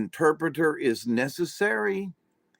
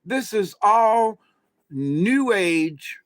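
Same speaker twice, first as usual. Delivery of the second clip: higher-pitched, drawn-out mock commentator; 7 semitones higher, 4 dB louder, slower than usual. Commentator pronouncing on the channeling documentary, mock-emphatic. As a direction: imitate a commentator making a big point. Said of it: "so the commentator was like".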